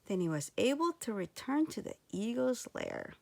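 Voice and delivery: plain reading voice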